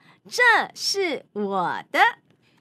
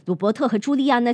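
ordinary delivery

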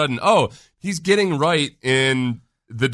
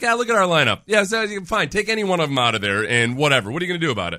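cocky fan; 6 semitones higher, faster than usual. Retold or imitated. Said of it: "I feel a little bit of like".

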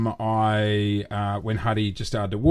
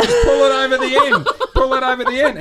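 high, raised voice cracking up with laughter; retold dad instruction, urgent and laughing; frantic coaching; 12 semitones higher, 5 dB louder, faster than usual. Dad demonstrating to son in the bath, hammed-up frantic coaching.

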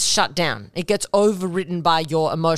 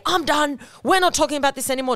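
high, fast, dismissive snap; a fast, high shutdown of the fight; curt shutdown; 7 semitones higher, faster than usual. Retold or imitated. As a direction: brush them off and end it. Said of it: "Do not say".

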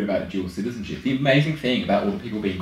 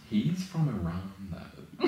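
pearl-clutching; 9 dB quieter, much slower than usual. Retold or imitated. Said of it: "I'm like".

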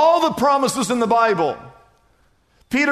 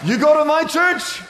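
astonished shout; 6 semitones higher than usual. Retold or imitated. Pastor shouts in disbelief at the stranger, astonished.

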